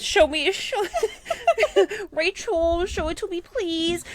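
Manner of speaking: high, laughing plea; excited begging. Real, not acted. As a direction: beg through laughter. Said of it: "begging friend to show video".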